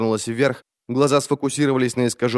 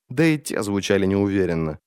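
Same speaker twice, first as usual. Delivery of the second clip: ordinary delivery, nothing marked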